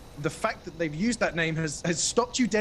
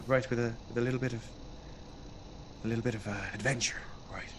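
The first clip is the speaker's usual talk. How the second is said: whispering; 6 semitones lower, 9 dB quieter, faster than usual. Real, not acted. GM hushes player mid-story.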